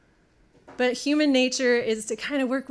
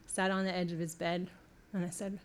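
usual speaking voice

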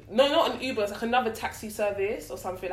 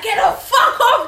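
high, loud, slow laughing yell; 14 semitones higher, 11 dB louder, slower than usual. Retold or imitated. Reenacting fighting off the man, laughing yell. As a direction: shout it while laughing.

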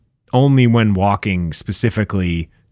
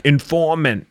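mock bad Boston accent; 6 semitones higher, slower than usual. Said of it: "they were saying".

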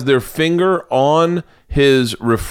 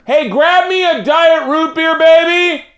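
much higher, louder sing-song call across the house; 4 dB louder, slower than usual. Real, not acted. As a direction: holler to the garage for a drink. Calling to wife in garage.

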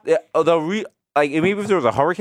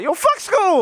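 mock kid rapper; 12 semitones higher, much slower than usual. Mocking his son's rap, a high bratty shout. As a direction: yell it like a kid rapping against school.

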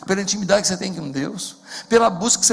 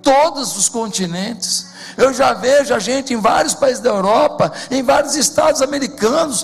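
rising from loud to a full-force preaching cry, higher and higher; pregação em crescendo; 6 semitones higher, 5 dB louder than usual. Acted. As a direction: build to the climax of the vision. Sermão em crescendo. (pregação inflamada, cada vez mais alta)

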